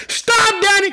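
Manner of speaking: high, loud "Stop, Daddy"; Jesus stopping the preacher, loud and high; exclaimed stop. Retold or imitated. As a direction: call out to halt everything. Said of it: "That's what he gonna say".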